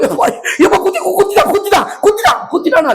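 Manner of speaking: high wailing imitation of a teary viewer; mock crying moviegoer. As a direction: ham up a sobbing cry of a moviegoer. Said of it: "திடீர்னு பார்த்தா அழுவ".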